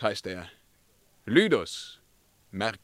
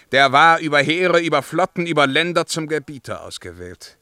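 rising, louder declaration of power; proud proclamation; 5 semitones higher, 7 dB louder than usual. Acted. Emperor boasts of being chosen to rule, proud loud declaration. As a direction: proclaim it like a ruler to the hall. Emperor recalls his crowning.

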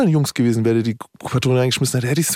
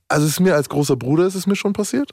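ordinary delivery

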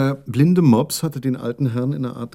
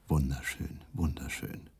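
low hollow undertaker murmur; sepulchral voice; 8 semitones lower, 8 dB quieter, slower than usual. Retold or imitated. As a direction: intone it like a voice from the grave. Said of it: "sagt plötzlich mit Grabesstimme".

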